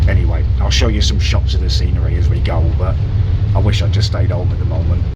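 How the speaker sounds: muffled behind a face mask; talking loudly through a mask on a bus. Real, not acted. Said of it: "hopefully you can hear me through my mask".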